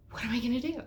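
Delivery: mock panic. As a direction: ask it in a hammed-up panic. Panicking over her inner chaos.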